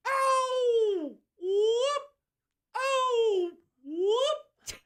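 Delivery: very high, loud swooping whoops; piercing, drawn-out mock warm-up calls; actor's vocal warm-up. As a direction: imitate an actor's falsetto warm-up siren. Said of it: "Just warming up".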